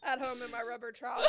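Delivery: laughing. Real, not acted. Joke amid laughter.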